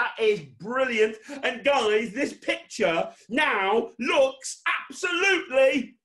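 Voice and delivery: loud, high, slowly drawn-out showman praise; hyped build-up. Pretend. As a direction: stretch out the big reveal. Hyped build-up to failed trick.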